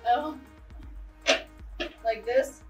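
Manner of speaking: normal talking voice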